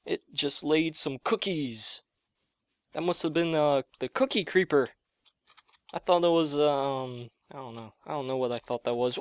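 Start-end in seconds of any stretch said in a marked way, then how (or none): none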